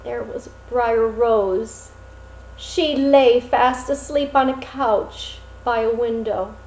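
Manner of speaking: usual speaking voice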